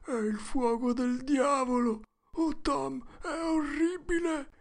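high, strained hushed wail; terrified. A narrator's reading. Huck sees devil fire in the dark, terrified. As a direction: wail it high without shouting.

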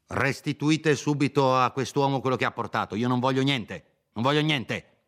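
commanding order. Acted. Dottore orders the maid.

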